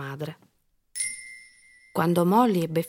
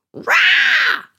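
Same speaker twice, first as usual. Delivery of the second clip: loud, very high "rah" scream; sudden shrieked monster growl; child's monster roar; 11 dB louder than usual. A narrator's reading. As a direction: roar to scare someone, pitched way up. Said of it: "gridò Molly".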